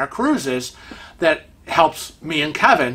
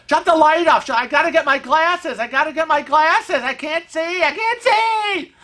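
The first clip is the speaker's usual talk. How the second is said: high, frantic yell close to tears; panicked near-crying scream; 10 semitones higher than usual. Retold or imitated. Husband without glasses screaming, nearly crying, in panic. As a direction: scream it like you're blind and terrified. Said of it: "he's screaming like he's practically crying".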